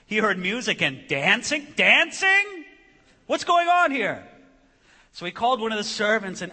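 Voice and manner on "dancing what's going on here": high, loud, incredulous outburst; indignant surprise. Acted. Older son hearing music at the house, indignant surprise. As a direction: exclaim it in loud disbelief.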